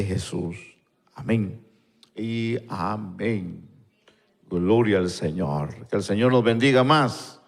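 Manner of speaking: earnest intercessory prayer, Señor after every phrase; a pastor praying before his church. Acted. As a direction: pray aloud for the stricken nations, steady and devout. Leads congregation in prayer.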